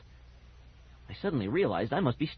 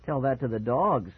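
usual speaking voice

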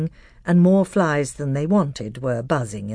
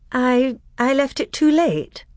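embarrassed; 10 semitones higher, 3 dB louder, slower than usual. Acted. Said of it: "said Susan in an embarrassed voice".

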